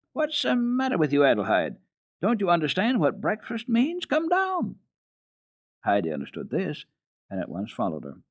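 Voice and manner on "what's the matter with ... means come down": high, sharp governess call up the stairs; a stern housekeeper calling a child, higher and louder; calling sharply. Acted. Governess calls Heidi down, sharp and high. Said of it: "called into Heidi's room".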